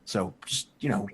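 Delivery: soft, low confidential warning; hushed warning. Acted. Edgar warning the crew quietly about Ace. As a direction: warn him under your breath.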